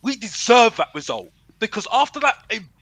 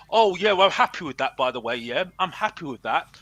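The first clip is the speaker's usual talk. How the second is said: low, softer mock contentment; mocking smug fans; 7 semitones lower, 4 dB quieter than usual. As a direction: parody the satisfied fan. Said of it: "look at that and say".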